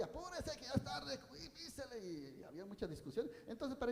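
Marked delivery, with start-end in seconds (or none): 0.0-2.0 high, hurried mock passenger urging the driver; pushy rider calling to the chofer; impatient passenger. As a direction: nag the bus driver to speed up. Impatient bus passengers yelling at driver.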